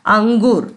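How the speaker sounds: "अंगूर" with the dot sounded as ng; nasal ng sound. Shown as a demonstration. Teacher shows the fifth-letter rule, ng in "अंगूर". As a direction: say "अंगूर" as ang-goor. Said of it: "अंग जैसा उसका उच्चारण होगा".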